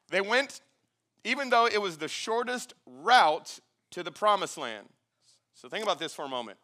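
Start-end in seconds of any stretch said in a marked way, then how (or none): 0.1-4.9 emphatic, raised Bible reading; scripture reading. Acted. Preacher resumes the passage for congregation, emphatic scripture reading. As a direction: read it out louder and higher, word by word.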